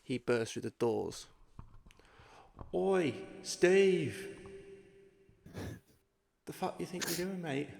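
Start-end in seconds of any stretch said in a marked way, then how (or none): 2.7-7.7 put-on raised, punchy South African accent. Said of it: "In his best South African accent".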